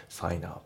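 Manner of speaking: "sign up" run together as sai-nup; linked words. Shown as a demonstration. Teacher showing learners linking in sign up, joined as one word. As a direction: carry the N of "sign" onto "up". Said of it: "sign upのところもリンキングで".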